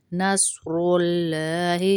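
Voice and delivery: نصر with a full, thick ص, not a thin س; slow نصر اللہ with heavy ص; heavy saad. Shown as a demonstration. Teacher models heavy ص in نصر. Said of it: "صاد کو ہر ممکن کوشش کر کے نکالنے".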